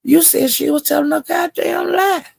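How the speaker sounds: high mocking callout of a liar; high exasperated callout. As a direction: call him out in a high incredulous voice. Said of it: "And I was saying".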